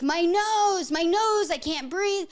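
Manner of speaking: high, loud mock wail; imitated child's screaming. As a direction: wail like a frantic half-asleep child.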